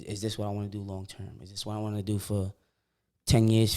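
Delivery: normal talking voice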